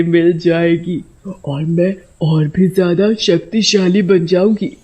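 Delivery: slow, eerie scheming witch voice; gloating witch. A narrator's reading. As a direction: savour every stolen hair. Witch gloats over stolen hair. (धीमी, रहस्यमयी चुड़ैल)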